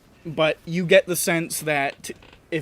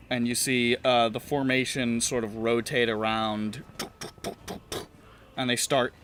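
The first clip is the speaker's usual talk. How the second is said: ordinary talk, nothing marked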